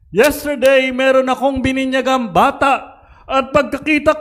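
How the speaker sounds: high, loud sermon storytelling; pitched high, carrying to the whole church; full-force preaching. Real, not acted.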